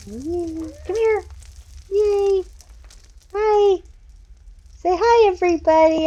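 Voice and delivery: high cooing pet voice; pet talk. Real, not acted. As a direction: coo over the kitty, pitched well up. Cooing to cat on stream.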